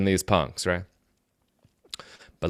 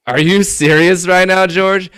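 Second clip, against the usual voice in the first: mock disbelief; 7 semitones higher, 6 dB louder than usual. Retelling his shock at George.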